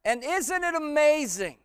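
high, loud, impassioned sermon delivery; full-force preaching. Real, not acted. Preacher telling a congregation of the criminal's life, full-force preaching.

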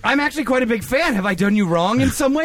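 high, earnest pleading; eager apologetic fan. Acted. Fan offering Jason Statham amends, eagerly apologetic.

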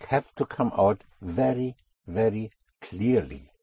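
ordinary delivery

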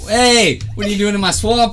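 loud laughing Shrek shout; Shrek impression. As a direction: yell it like an angry ogre.

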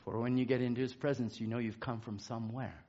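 very soft, low reflection; hushed preaching. Real, not acted. Preacher reflects on God's presence after a hymn, hushed and low. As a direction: drop right down, almost to nothing by the end.

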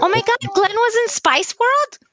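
high-pitched amazed question, drawn out at the end; real surprise, voice shooting up; astonished. Real, not acted. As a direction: react with real, delighted disbelief. Just discovers a surprising film credit.

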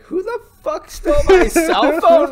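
high, loud, slowed outburst; mock outraged yell. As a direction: imitate an indignant movie character shouting. Acts out Michael Cera's outburst.

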